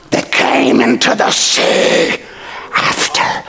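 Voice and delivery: slow whispered scripture; slow whispered telling; hushed reading. Acted. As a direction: let it drop to a whisper.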